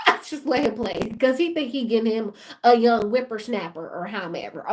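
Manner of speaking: ordinary talk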